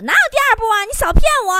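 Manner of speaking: high, loud mock-angry outburst; flustered fury. Wife accusing husband of tricking her, exasperated high yell. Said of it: "气急败坏问我".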